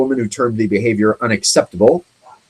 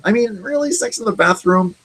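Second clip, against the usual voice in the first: mock disbelief; 9 semitones higher, 5 dB quieter than usual. Real, not acted.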